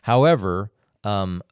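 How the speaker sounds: usual speaking voice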